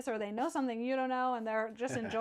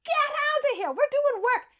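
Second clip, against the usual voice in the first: shouting. Acted. Sailor shouting then merman catcall.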